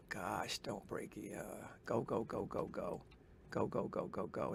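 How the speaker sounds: hushed, anxious urging; tense whisper. Real, not acted. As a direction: whisper it under your breath.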